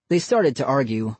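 usual speaking voice

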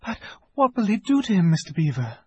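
high, hurried anxious question; fearful and urgent, pitched well up; alarmed gasp. A narrator's reading. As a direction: gasp it out as a worried girl. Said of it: "gasped Lucy".

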